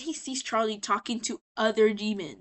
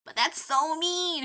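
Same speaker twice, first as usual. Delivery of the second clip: higher, drawn-out whine; mock whiny objection; 6 semitones higher, slower than usual. Princess told to be meaner, mock whiny protest. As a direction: protest like a softhearted princess, slowly.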